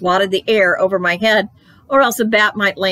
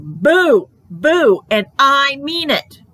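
shouted boos, louder and higher; loud scary boo; 7 semitones higher, 4 dB louder, much slower than usual. A narrator's reading. Kid clown shouting boo at two girls, loud and insistent. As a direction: yell it to scare them. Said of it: "very frightening".

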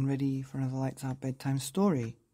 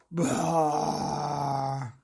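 big angry sheep bleat; mad baa. Acted. Marvin stamping and letting out a big mad baa. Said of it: "let out a big mad".

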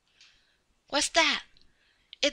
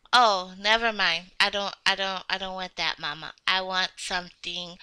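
put-on low, soft childlike pondering; low and hushed, half to oneself; undecided mumbling; 11 semitones lower, 7 dB quieter than usual. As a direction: think aloud quietly while choosing. Losing interest, choosing slowly.

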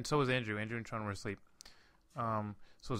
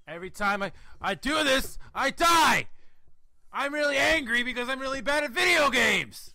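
shrill top-of-the-lungs gamer meltdown, much higher and louder; Rage Quit Michael; 8 dB louder than usual. Pretend. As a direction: scream like a gamer losing it at every death. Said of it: "Pretend you're fucking playing a Rage Quit game".

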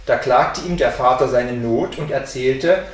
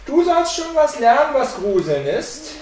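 high, raised gleeful thought; scheming sexton; 10 semitones higher, 5 dB louder than usual. A narrator's reading. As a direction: relish the secret plan, pitched well up. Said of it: "dachte er".

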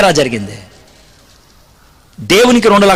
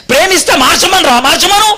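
angry demand; 9 semitones higher than usual. Retold or imitated. Angry argument with friend.

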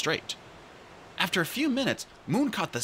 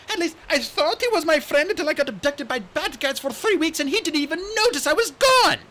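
put-on high, bright young dragonette voice; chirpy excited young dragon; eager little RainWing voice; Kinkajou's voice; 6 dB louder than usual. Kinkajou complaining blithely.

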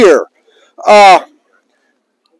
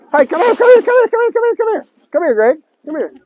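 beckoning call; 8 semitones higher, 6 dB quieter, much faster than usual. Real, not acted. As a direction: rattle off a high-pitched call to come over. Calling Greg over.

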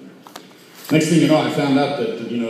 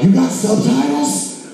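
put-on amazed drunk; 13 semitones higher, slower than usual. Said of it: "They're like".

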